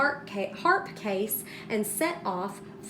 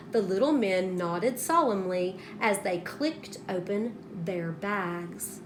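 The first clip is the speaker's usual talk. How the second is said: ordinary delivery, nothing marked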